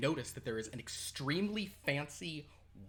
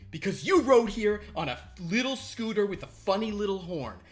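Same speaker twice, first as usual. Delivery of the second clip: furious brag; 6 semitones higher, 9 dB louder than usual. Acted. Boss watch snapping at the worker who asked his name, loud and high. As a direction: bite his head off.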